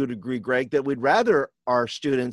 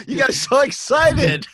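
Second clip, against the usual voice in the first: high, giggling through words; laughing; 11 semitones higher, 5 dB louder than usual. Real, not acted. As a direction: laugh while you tease. Laughing at mic drop.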